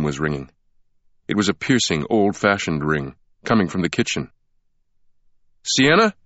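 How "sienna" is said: calling out. A narrator's reading. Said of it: "Langdon called out".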